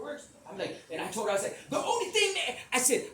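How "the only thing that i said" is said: high, loud insistence; retold heated argument. Speaker arguing with Sweet, loud and heated. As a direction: argue it loudly, pitched way up.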